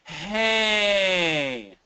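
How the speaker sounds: long, high falling "hey"; moaned "hey". Shown as a demonstration. Teacher demonstrating connected voice, drawn-out moaned call. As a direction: stretch "hey" out like a moan. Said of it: "Moan, moan from wherever you are".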